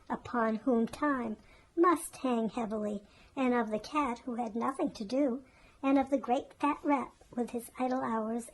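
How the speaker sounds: plain reading voice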